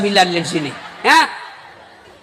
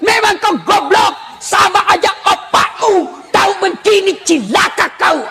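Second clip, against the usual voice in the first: insulting husband; 6 semitones higher than usual. Acted. Wife hurling insults at her lazy husband, furious shout. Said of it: "tidak boleh maki-maki suami".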